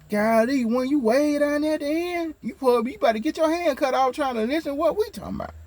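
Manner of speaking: very high squeaky mock busybody; mock nosy voice. Mocking nosy folks, high voice.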